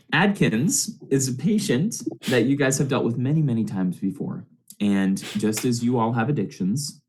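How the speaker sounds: ordinary delivery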